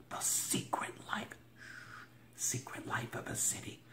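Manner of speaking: breathy secretive whisper of the subtitle; dramatic whisper. Acted. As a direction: hush it like a secret. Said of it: "dramatic whisper of the title".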